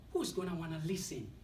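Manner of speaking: a sharp mocking inner critic; mock the speaker as his doubting self; negative inner voice. Self-talk belittling his accent, mocking inner critic. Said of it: "negative self-talk that talks to us".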